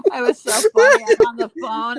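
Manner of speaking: loud, high laughter through the words; cracking up while talking; laughing. Real, not acted. Laughing at her own joke.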